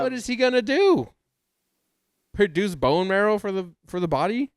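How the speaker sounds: high, loud disbelief; incredulous outburst. Real, not acted. Co-host questioning a rib's use as a hero, high incredulous outburst. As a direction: exclaim it in exasperated disbelief.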